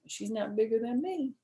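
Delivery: ordinary delivery, nothing marked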